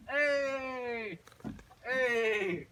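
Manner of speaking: loud, high, long-held "hey"; joyful cheer. Retold or imitated. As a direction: cry out in delighted greeting. Acted joyful reunion shout.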